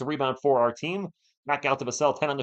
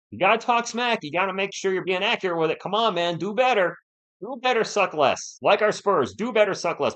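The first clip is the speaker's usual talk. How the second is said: louder, higher scolding of a chatter, half joking; mock scolding; 6 semitones higher, 4 dB louder than usual. Real, not acted. Streamer scolds a chatter's bad smack talk, loud and mocking. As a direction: lecture the trash-talker with a grin.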